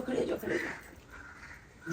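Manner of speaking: whispered, slow prompt under the breath; slow unvoiced nudge. Real, not acted. Host prompting child quietly.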